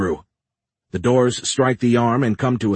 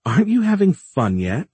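high, swooping playful taunt; taunting AI villainess; 8 semitones higher, 3 dB louder than usual. A narrator's reading. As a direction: tease your cornered prey in a sing-song lilt. Gal taunts the cornered narrator.

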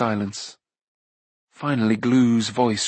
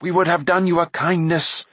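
higher-pitched, hurt protest; mildly raised, reproachful voice; indignant cry; 6 semitones higher, 3 dB louder than usual. A narrator's reading. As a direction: cry out at a betrayal. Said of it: "Taran cried".

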